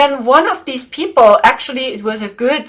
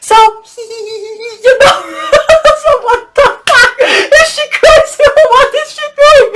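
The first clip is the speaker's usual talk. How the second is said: high, loud, giggling through words; laughing; 11 semitones higher, 5 dB louder, faster than usual. Real, not acted. Speaker recalls laughing at the dracos, laughing hard. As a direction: laugh it out with glee. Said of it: "I was laughing".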